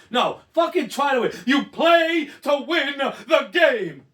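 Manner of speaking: emphatic, slow shout; loud rant. Retold or imitated. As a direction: drive home each word. Quoting famous coach rant.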